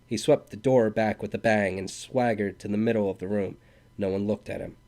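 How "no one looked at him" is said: plain reading voice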